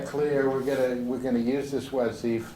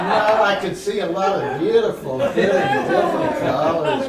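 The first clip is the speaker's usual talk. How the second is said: talking through laughter; louder, higher, laughing as you speak; 10 semitones higher, 8 dB louder than usual. Real, not acted. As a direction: answer while still laughing.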